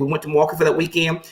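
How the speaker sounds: normal talking voice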